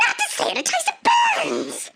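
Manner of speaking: very high-pitched indignant shriek; pained screech. Acted. Woman with salt water on her cut, shrieking. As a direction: wail it in hammed-up pain.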